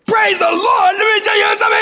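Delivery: high, strained shouting back; mock sergeant yell. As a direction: mimic an outraged gunny repeating it. Gunny yells back the phrase.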